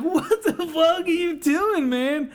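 hammed-up stoner demanding answers; imitating the kid. Retelling the kid getting tackled, slow high dopey outrage. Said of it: "And he was like".

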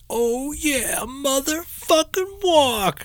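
high, drawn-out mock whoop; slow falsetto fanboy imitation; mocking excited fan. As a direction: stretch it out high like an overexcited fan. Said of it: "and the guy's like".

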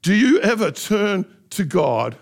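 ordinary talk

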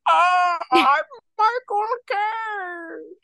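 very high, drawn-out mock wail; Michael Caine impression. Host shows off his own impression to co-host, hammed-up high caricature. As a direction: stretch every word into a high, strained caricature. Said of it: "You wanna hear mine?".

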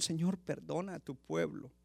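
a soft, broken plea to God; tearful prayer. Acted. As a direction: Moses weeping before the Lord, hushed and pleading. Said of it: "llorando delante del Señor".

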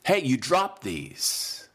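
slow, drawn-out helpful call; retold call out. Young man calling after a passenger about dropped keys, slow mock call. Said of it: "He was yelling at this guy".

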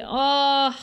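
real anguished "ugh"; pained groan. Real, not acted. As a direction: let out a long sympathetic groan. Groans again at painful scene.

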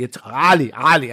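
imitated correction, ALI opened with a guttural A. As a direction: stress ALI with a throaty guttural first A. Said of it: "guttural A".

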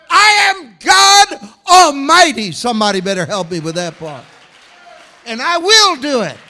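booming, emphatic declarations as God; thundering pulpit proclamation; full-force preaching. Acted. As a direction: preach it loud, soaring to high shouts on the biggest claims.

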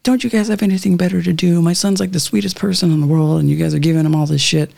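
high-pitched retold scolding; mother yelling. Mother defending their child to the police, mock yelling. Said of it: "my mother yelling at him".

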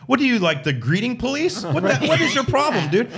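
high, scoffing retort; mocking disbelief. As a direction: scoff at the greeting police. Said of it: "I'm like".

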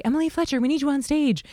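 high, bright stage manager call; mock backstage call. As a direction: call her name up high like over the tannoy. Stage manager calling her.

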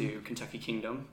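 ordinary delivery

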